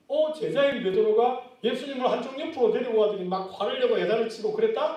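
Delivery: ordinary talk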